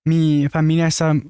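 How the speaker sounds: mocking a Mexican housekeeper's accent. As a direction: parody a Spanish-speaking housekeeper, lightly. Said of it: "let's pretend that's not racist".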